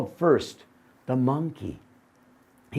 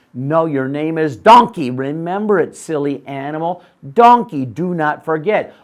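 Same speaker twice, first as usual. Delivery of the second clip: loud insistence; 8 dB louder than usual. Acted. Man insisting loudly.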